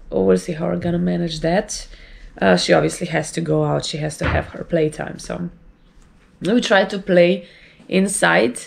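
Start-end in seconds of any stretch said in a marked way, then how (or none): none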